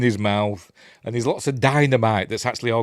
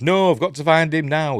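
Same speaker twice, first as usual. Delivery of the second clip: mildly voiced Chloe; 7 semitones higher, 6 dB louder than usual. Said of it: "And Chloe says".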